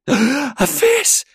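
sharp gasp, then a high frightened cry; voice breaking with fear. A narrator's reading. Child sees a face in the darkness, voice breaking with fear. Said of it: "her voice beginning to break with fear".

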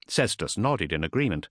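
ordinary delivery

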